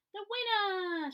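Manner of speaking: high, slow, loud call across a lake; big happy shout across the water. A narrator's reading. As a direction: call out triumphantly. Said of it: "shouted Gumboot across the lake".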